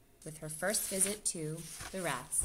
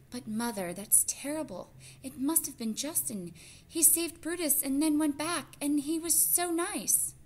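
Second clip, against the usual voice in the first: put-on much higher, louder upset girl voice; a little mouse near tears, protesting; distressed young girl; 10 semitones higher, 4 dB louder than usual. Teresa protests to her mother, much higher and distressed.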